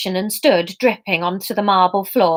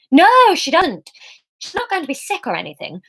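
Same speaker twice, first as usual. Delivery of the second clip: flustered denial tumbling out; panicky; 8 semitones higher, 3 dB louder than usual. A narrator's reading. Emma panicking at the reception desk. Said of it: "Emma was starting to panic".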